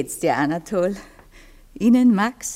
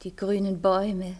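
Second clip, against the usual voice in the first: trance voice; 7 semitones lower than usual. Acted. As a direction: speak as if far away in sleep. Cora speaking in trance.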